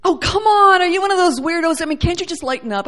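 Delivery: high, exasperated jeer speeding up; mocking scoffer. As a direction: imitate someone making fun, whiny protest. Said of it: "been made fun of by many".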